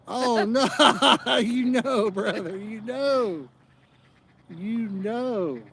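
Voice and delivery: high and loud, laughing through the words; breaking up, can't get the words out. Real, not acted. Cracking up loudly with his fishing buddy over his wife. Laughing fit mid-sentence.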